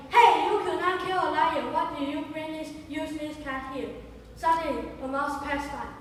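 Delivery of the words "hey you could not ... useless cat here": loud scolding shout; furious wife scolding her husband. Acted. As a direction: yell at him for bringing home a useless cat. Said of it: "his wife shouted at him".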